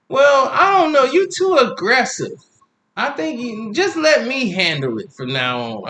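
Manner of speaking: scolding mother. Retold or imitated. Mother telling him to back off, high voice. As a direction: tell him he's too aggressive.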